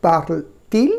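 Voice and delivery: parle-t-il split by a distinct linking T; inserted T sound. Shown as a demonstration. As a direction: put a clear T before il in "parle-t-il". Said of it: "ওটাকে আটকে দিলাম টিটাকে".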